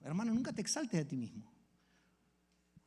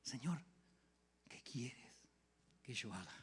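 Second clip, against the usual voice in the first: softer, whispered prayer; humble whisper; 4 semitones lower, 9 dB quieter, slower than usual. Shown as a demonstration. Preacher showing the congregation how to pray, hushed. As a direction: model the quiet prayer for them. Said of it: "Qué tal si le preguntás eso a Jesús".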